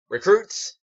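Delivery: harsh shout. A narrator's reading. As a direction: bark the word across the field. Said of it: "The harsh voice broke his train of thought".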